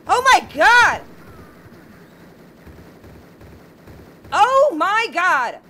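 loud, high, stretched-out panic; panicked shriek. Real, not acted. Player screams at the game in panic. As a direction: cry out as enemies swarm.

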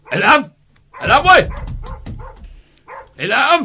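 high, loud call across the yard. Acted. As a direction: yell his name to reach him outside. Calling henchman from afar.

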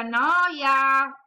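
put-on fed-up child shouting noia; bursting out. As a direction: explode on the final noia like a bored child. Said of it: "sbottò".

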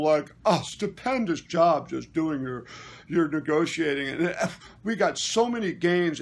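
loud, pompous imitation of a flatterer; big booming voice. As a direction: boom out overblown praise, mocking him. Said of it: "he had this big, deep voice".

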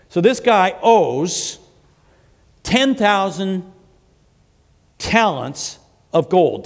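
each word drawn out slowly for weight; slow emphatic. Real, not acted. Preacher to congregation about the debt, slow and emphatic. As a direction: let the huge sum land, slowly.

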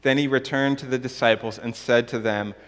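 measured, emphatic pulpit reading; scripture reading. Real, not acted. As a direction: read the Gospel out solemnly to the congregation, stretching key phrases. Reads the Gospel passage aloud.

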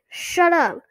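high, loud, irritated snap; snapped. A narrator's reading. Sister silences the dummy, irritated loud snap. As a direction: cut the dummy off sharply. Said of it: "Chris snapped to the dummy".

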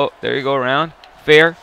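ordinary talk, nothing marked

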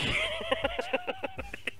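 high squeal laugh. Real, not acted. Laugh after kick.

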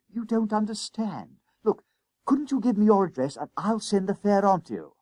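high-pitched schoolboy urging; desperate pleading. A narrator's reading. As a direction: plead urgently with the conductor. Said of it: "urged Jennings desperately".

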